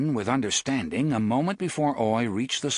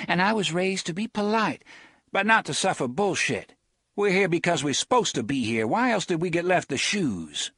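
higher, proud and calm; lightly voiced Susanna; 7 semitones higher than usual. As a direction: speak with polite defiance.

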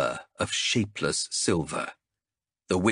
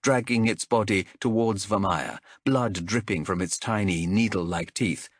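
plain reading voice

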